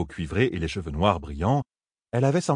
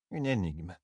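muttered; 2 semitones lower, 7 dB quieter than usual. A narrator's reading. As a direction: mutter it softly to yourself. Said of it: "marmonna-t-elle". (marmonné tout bas, pour soi)

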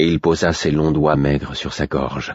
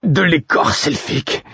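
higher, choked strangled cry; 10 semitones higher than usual. A narrator's reading. Narrator failing, begging for elfbark, strangled. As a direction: force it through a tight throat. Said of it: "d'une voix étranglée".

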